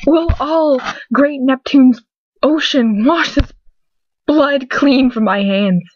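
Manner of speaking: lightly dramatic Shakespeare reading; a touch of tragic actor on the line; Macbeth quote. Acted. Narrator opening with Macbeth, lightly dramatic. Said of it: "William Shakespeare, Macbeth".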